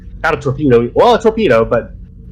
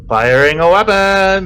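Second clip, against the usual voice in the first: loud callout; 8 semitones higher, 5 dB louder than usual. Acted. Announcing weapons fire in game.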